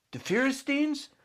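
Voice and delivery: put-on high excited boy's cry; eager child. A narrator's reading. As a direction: trumpet it out eagerly. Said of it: "the little boy trumpeted".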